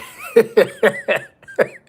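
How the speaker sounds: loud high burst of laughter. Real, not acted. Vlogger amused that PMC heard everything, laughing out loud. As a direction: crack up at the chat joke.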